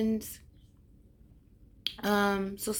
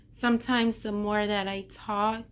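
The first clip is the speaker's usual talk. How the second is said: ordinary delivery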